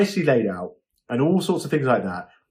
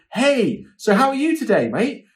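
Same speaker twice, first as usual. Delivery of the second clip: cheery host greeting; 9 semitones higher than usual. Shown as a demonstration. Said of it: "So if you're like".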